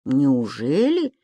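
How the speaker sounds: low, slow disbelieving question; doubtful. A narrator's reading. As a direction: drag it out in disbelief. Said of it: "недоверчиво спросил".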